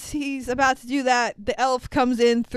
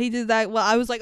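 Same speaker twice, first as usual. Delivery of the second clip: ordinary talk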